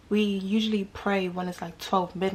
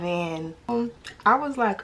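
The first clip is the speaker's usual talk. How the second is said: ordinary talk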